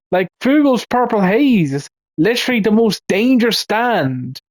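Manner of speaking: high-pitched, exasperated ranting; exasperated fan on a rant; worked-up rant. Real, not acted. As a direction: rant in real disbelief, pitch riding high. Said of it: "enough of that rant".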